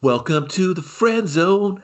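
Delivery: slow, high teasing; mocking quip. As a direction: drag it out to tease.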